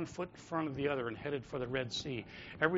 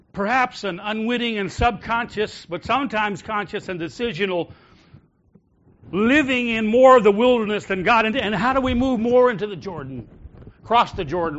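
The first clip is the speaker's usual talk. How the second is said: louder and louder, higher preaching cry; full-force preaching; 7 semitones higher, 11 dB louder than usual. Acted. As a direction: preach it out loud to the congregation. Preacher building to a shout.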